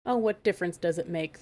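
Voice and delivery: plain reading voice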